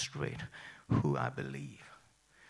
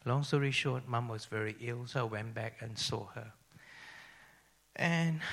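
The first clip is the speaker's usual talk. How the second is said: ordinary talk, nothing marked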